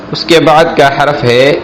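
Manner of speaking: usual speaking voice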